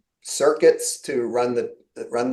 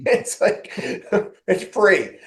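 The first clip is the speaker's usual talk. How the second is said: breathy laugh through the words; 6 semitones higher, slower than usual. Real, not acted. Amused speaker laughing that video calls are now free. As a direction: laugh it out, half-swallowed.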